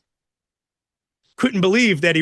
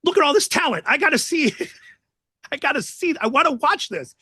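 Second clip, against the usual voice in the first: eager pleading; 9 semitones higher, faster than usual. Retold or imitated. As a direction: gush over the talent like a kid. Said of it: "he's like".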